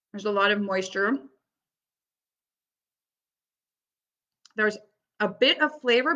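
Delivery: talking with mouth full; chewing carrot while speaking; messy loud eating. Real, not acted. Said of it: "I'm talking with my mouth full".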